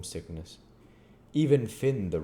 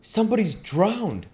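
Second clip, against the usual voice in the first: put-on high, loud boyish alarm; startled boy shouting the news; excited cry; 7 dB louder than usual. A narrator's reading. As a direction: call it out much higher. Tom's alarmed discovery.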